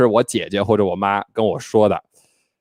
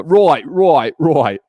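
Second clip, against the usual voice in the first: higher, louder caricatured Australian accent; over-the-top Australian accent; 5 semitones higher, 4 dB louder, much slower than usual. Shown as a demonstration. Host shows how he mocked an Australian accent, over the top. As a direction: deliberately overdo a cheap Australian accent. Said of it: "就是有点儿过分啊".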